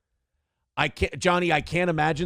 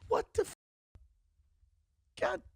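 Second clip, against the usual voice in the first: much higher, softer mock disbelief; mock shock; 7 dB quieter than usual. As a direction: squeak it out as if floored by the news. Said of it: "And I'm like".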